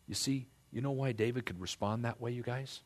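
ordinary talk, nothing marked

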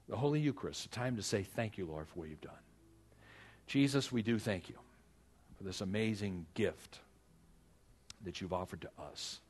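ordinary delivery

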